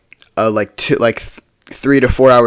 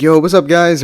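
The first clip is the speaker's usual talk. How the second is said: higher-pitched, hailing the whole group; upbeat greeting; 6 semitones higher, 4 dB louder than usual. Retold or imitated. Luke greets friends at the bonfire, upbeat greeting. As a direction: act out a friend's breezy hello.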